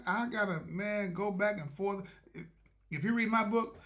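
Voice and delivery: ordinary talk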